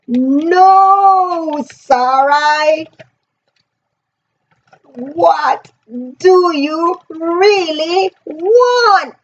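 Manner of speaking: slow, drawn-out searching question; gentle probing question. Retold or imitated. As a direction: coax the truth out slowly. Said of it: "He's like".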